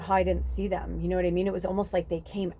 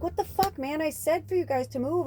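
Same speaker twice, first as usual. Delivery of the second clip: much higher, indignant mock yell at the snakes; retold angry outburst; 10 semitones higher than usual. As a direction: replay the angry snap at the snakes. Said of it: "I was angry at the snake".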